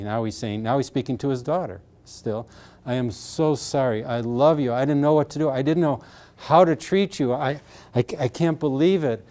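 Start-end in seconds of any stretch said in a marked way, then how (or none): none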